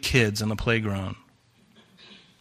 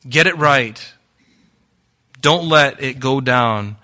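higher, louder, fast preaching; preaching at full force; 4 semitones higher, 6 dB louder than usual. Acted. Pastor urging the church to settle anger, preaching hard. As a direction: drive it home to the congregation.

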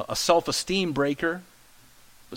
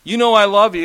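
Peter insisting, louder; earnest protest; 4 semitones higher, 8 dB louder than usual. Acted. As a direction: push the line out like a hurt friend. Peter's earnest reply to Jesus.

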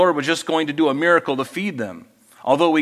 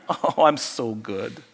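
slow, low, preening self-praise; self-admiring sigh; 5 semitones lower, 5 dB quieter, slower than usual. Retold or imitated. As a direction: savour your own goodness. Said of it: "not about all my good works".

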